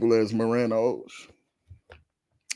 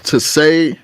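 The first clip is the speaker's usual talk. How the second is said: usual speaking voice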